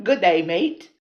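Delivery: laughing, loud Australian greeting; Aussie accent attempt. Shown as a demonstration. Practising for Australian viewers, laughing Aussie accent. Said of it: "Just stretch, stretch your lips out".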